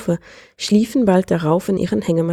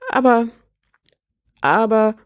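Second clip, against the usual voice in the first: higher, halting disbelief; struggling for composure; 5 semitones higher than usual. A narrator's reading. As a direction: falter as if lost for words. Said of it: "sie rang um Fassung".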